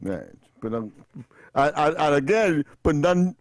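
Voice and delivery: normal talking voice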